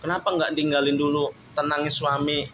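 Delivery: ordinary talk, nothing marked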